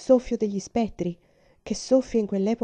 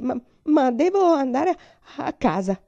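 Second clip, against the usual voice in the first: teeth chattering; 6 semitones higher, 6 dB louder than usual. Acted. Frightened girl insisting to her uncle she is not cold, stammering. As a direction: trip over the words as if teeth chatter. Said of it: "a cui battevano i denti".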